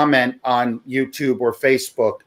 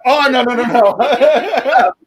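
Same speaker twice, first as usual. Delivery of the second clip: laughing; 8 semitones higher, 5 dB louder, slower than usual. Real, not acted. Laughing denial of wife mixup.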